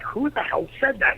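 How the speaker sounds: high-pitched retold challenge; indignant demand. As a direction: demand to know who spoke, imitating him. Rock reacts to unseen heckler.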